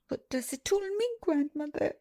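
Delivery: high sobbing question. A narrator's reading. Princess sobs her question to grandmother, voice high. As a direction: ask through sobs, pitch lifted. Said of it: "she sobbed".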